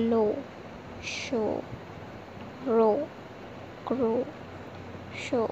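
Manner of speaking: long ओ in लो, शो, रो, क्रो. Shown as a demonstration. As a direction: say each word alone with a held final ओ. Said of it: "ओ का दीर्घ उच्चारण". (लो, शो, रो, क्रो में दीर्घ ओ)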